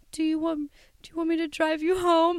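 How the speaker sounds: high, fast, caring offer; a boyfriend gently offering a ride home; sweet boyfriend. Retold or imitated. Quoting sweet boyfriend Ryan.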